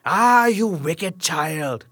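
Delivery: slow drawn-out witch voice, a touch of menace; witch's cry. A narrator's reading. Witch scolds Rapunzel, slow hammed-up hag voice. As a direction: drag out the scolding like an old hag. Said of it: "cried the witch".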